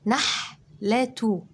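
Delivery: كلمة نحلة drawn out very slowly and clearly; slow stretched word. Shown as a demonstration. Teacher slowly says نحلة for children to write. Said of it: "هنكتب كمان كلمة".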